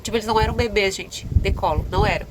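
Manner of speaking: ordinary talk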